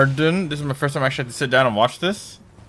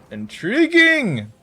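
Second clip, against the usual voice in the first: drawn-out high intriguing; 9 semitones higher, slower than usual. Acted.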